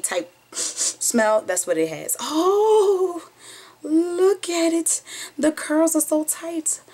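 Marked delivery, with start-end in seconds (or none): 2.2-6.8 high, slow, delighted gush; a high, drawn-out coo of delight over the curls. Real, not acted. Admiring the new curls.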